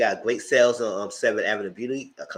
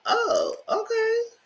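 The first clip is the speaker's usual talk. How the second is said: very high sing-song "oh, okay"; high mock-polite surprise; mock surprised; much slower than usual. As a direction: pipe it up high like a fake-polite reaction. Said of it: "I said".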